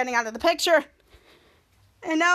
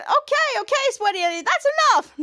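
very high, pinched cartoon voice; squeaky villain voice; 10 semitones higher, 3 dB louder than usual. Frankini tells Sweaty Eddie to stop, in a very high squeak.

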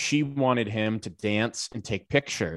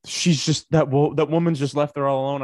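indignant quote; 5 semitones higher, 6 dB louder than usual. Retold or imitated. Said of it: "Sarah's just like".

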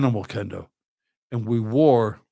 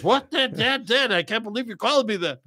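shocked son; 9 semitones higher than usual. Retold or imitated. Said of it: "He goes".

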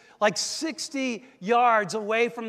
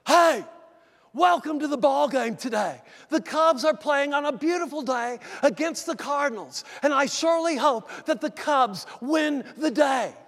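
Harry Carey; 4 semitones higher than usual. Retold or imitated. Pastor shows the congregation his announcer impression, shouting. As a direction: ham up an old-time baseball broadcaster. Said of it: "did my Harry Carey".

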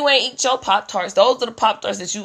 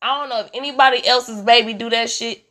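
ordinary delivery

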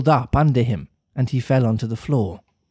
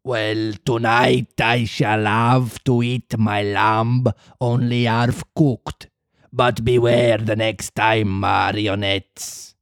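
slow gruff puppet master warning marionettes; sighing warning; 2 semitones lower, slower than usual. Acted. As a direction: grumble a warning. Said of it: "sighing and wagging his head".

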